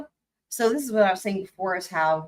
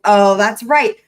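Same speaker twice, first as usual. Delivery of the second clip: normal talking voice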